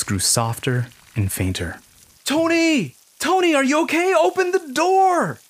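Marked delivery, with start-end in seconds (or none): 2.2-5.4 high, loud worried calls; calling out. Acted. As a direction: shout through the door. Calling to silent friend.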